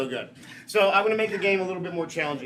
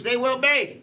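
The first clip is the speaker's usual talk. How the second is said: rising showman's lead-in; crowd cue; 7 semitones higher, slower than usual. Pretend. Host cues audience to finish the foul, showman's crowd cue. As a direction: set up the crowd's shout with a lift.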